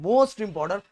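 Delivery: most punched hard; teacher urging students that it matters, loudly. Shown as a demonstration.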